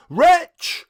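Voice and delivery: high, loud anguished cry; sudden loud high outcry. A narrator's reading. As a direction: hurl the word at the bird. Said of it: "I cried".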